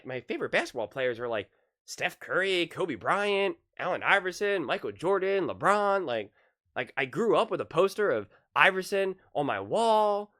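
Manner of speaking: usual speaking voice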